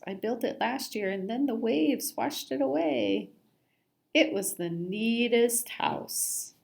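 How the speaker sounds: ordinary delivery, nothing marked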